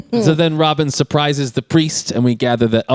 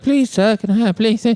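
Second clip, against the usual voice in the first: high-pitched pleading beggar; imitated beggar's plea; begging; 8 semitones higher than usual. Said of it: "a bunch of beggars like".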